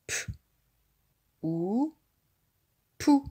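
letters apart, then "pou" firmly; sounding out. Shown as a demonstration. Teacher to child spelling the syllable: letters then "pou". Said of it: "sounding out letters".

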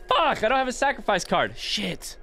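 gasp, then high-pitched swearing; real startled "oh no" reaction; dismayed realisation. Real, not acted. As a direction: blurt it high in sudden dismay. Realises mistake mid-game.

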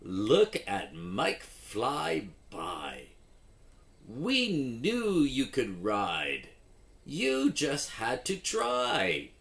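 lively storybook read with character voices; reading to children. Acted. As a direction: act out the story for young readers. Reads phonics story with voices.

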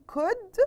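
"could" said as /kud/, the L never sounded; silent L. Shown as a demonstration. Teacher to pupils, "could" with silent L. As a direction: say "could" like "cud", no L at all. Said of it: "we pronounced the word as if there is no L".

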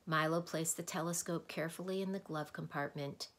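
ordinary delivery, nothing marked

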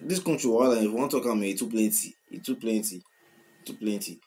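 usual speaking voice

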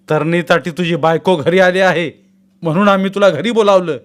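loud, high furious rebuke; angry scolding. A narrator's reading. Father berating his weeping son, loud and furious. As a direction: thunder at him like an enraged father. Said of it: "Anandrao चिडले".

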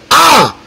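high, sudden "ah"; retold pain yell. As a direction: cry out as if just bitten, acted. Imitates his yell when bitten.

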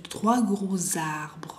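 "gros arbres" linked with a z sound; liaison demonstrated. Shown as a demonstration. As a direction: slowly join "gros arbres" as gro-zarbres. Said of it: "Se escucha la, la, la". ("gros arbres" enlazado con sonido z)

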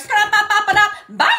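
high, silly nonsense chatter; comic scatting. Retold or imitated. Employee escaping from the back, comic high scatting. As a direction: rattle it off in a squeaky voice. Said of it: "Now I'm in the back like".

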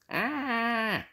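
falling witch's shriek; high shriek. Acted. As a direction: scream as you fall. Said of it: "shrieked Edna".

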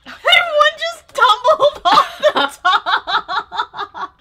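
slow, loud laughing cry; delighted laughing exclamation. Real, not acted. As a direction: laugh the words out long. Laughing at the scene.

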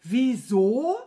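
loud, incredulous rising echo; outraged echo. A narrator's reading. Wizard incredulous at his stupid servant, outraged. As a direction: throw the question back, exploding.

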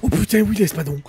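loud, thrilled outburst; excited shout. Real, not acted. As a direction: yell it out in delight. Finds the sword he wanted.

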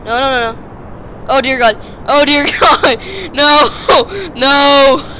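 loud panicked no's; panicked shouting. Real, not acted. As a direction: cry out as it all goes wrong. Rocket crashing, crying out.

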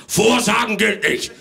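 sharp, loud rebuke; gruff. Acted. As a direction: snap at her like a strict Santa. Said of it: "sagte der Weihnachtsmann barsch".

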